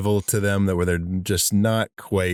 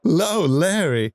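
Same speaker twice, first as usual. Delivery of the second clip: mock greeting; 9 semitones higher than usual. Greeting an imagined guest.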